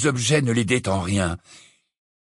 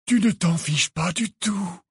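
very calm; 8 semitones higher, slower than usual. A narrator's reading. Dumbledore answering Harry's outburst, very calm. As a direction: contradict gently, unhurried. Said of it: "Il paraissait très calme".

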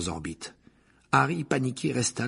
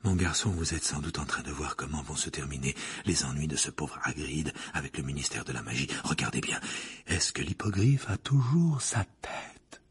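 lower, hushed, ominous voice; gloomy seer; 6 semitones lower than usual. A narrator's reading. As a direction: lure him toward a grim vision. Trelawney prophesying gloom.

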